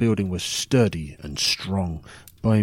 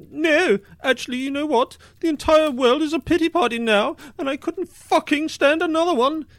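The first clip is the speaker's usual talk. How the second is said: much higher, louder whiny exasperated voice; mock fed-up listener; 4 dB louder than usual. Storyteller mimicking a listener who wants to leave, high and whiny. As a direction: parody a sneering drunk who has had enough. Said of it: "are thinking".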